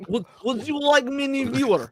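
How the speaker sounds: high-pitched Russian spam bot; Russian-accented bot making a sales pitch; mock Russian accent. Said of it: "Need more of a Russian accent".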